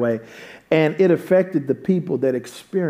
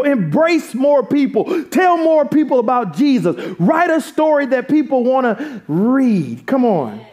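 high, punchy preaching with stretched key words; fervent exhortation; 9 semitones higher than usual. Real, not acted. Pastor urging congregation to live out faith, fervent exhortation. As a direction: urge them on, line after line.

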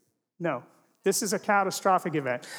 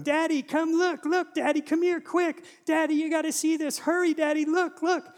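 put-on much higher, excited child's call; little daughter calling; 11 semitones higher than usual. Dad imitates his daughter calling him at dawn, high and excited. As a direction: imitate a small girl calling her dad to come quickly. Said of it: "she is yelling from her room".

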